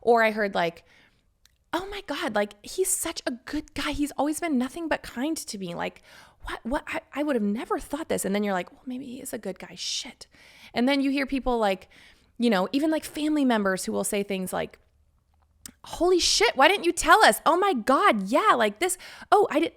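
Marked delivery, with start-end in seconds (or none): 1.7-8.2 high, breathy gasping gossip friend; mock shocked friend. Friend reacting to the news, high mock shock. 8.7-10.3 whispered private doubt. Retold or imitated. You doubting yourself after the gossip, whispered. 15.8-19.7 high, loud shocked relatives; mock outraged family. Family members finding out, high shocked exclaiming.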